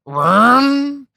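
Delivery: high imitated engine rev; car noise. Shown as a demonstration. Showing kids' car-noise slang, high engine rev. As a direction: rev it like a motor. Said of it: "any noise a car makes".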